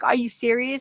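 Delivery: ordinary talk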